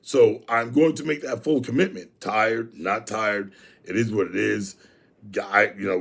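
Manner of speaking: ordinary delivery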